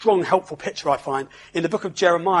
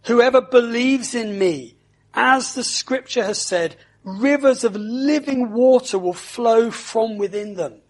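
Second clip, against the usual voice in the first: raised, carrying proclamation; loud voice; 5 semitones higher, 5 dB louder than usual. Acted. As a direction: call it out to the whole crowd. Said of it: "he said in a loud voice".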